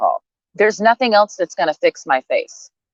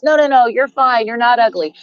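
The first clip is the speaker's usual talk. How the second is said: mock reassurance; 6 semitones higher than usual. Mocking people who once called her ugly, with sugary mock reassurance. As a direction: parody people backpedaling sweetly. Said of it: "circles back around and says".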